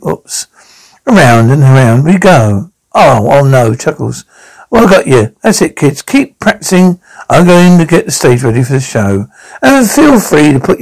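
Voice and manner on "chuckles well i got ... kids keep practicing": ordinary delivery, nothing marked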